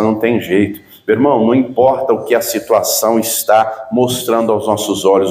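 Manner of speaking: ordinary talk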